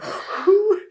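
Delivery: a loud, high, breathy exhale of relief; relieved whew. Retold or imitated. Mother recalling crowning, lets out relieved whew. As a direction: blow out a big whew as the baby crowns. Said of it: "I was like".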